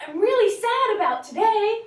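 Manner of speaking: high and loud, not sad at all; mismatched cheerful. Shown as a demonstration. Teacher showing a voice that doesn't match sad words. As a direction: sound bright while saying sad words. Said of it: "I was just like".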